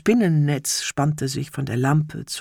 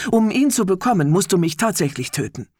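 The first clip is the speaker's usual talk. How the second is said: firm, raised warning; clear commanding voice; 5 semitones higher, 4 dB louder than usual. Acted. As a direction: state it clearly, leave no doubt. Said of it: "mit klarer Stimme".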